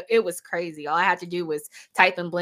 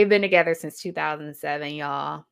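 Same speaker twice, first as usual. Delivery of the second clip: ordinary talk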